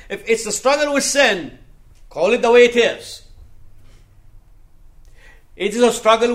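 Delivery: loud, high-pitched sermon delivery; forceful preaching. Real, not acted. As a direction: preach it out with full conviction. Preaches confession of sin at full force.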